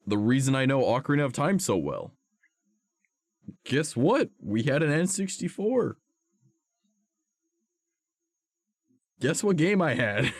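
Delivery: high, eager and playful; building up to the reveal; excited. Real, not acted. Excited nostalgia, rising voice.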